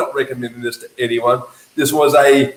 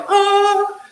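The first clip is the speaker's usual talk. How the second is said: squeal of delight. Retold or imitated. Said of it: "You're like".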